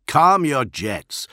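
stretched-out, firm restraint; firm calming order. A narrator's reading. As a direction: rein in an angry friend. Solomon restrains angry Julius.

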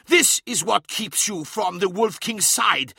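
slow, high-pitched accusation; crying out. A narrator's reading. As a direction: hurl the accusation. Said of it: "cried Skarsen".

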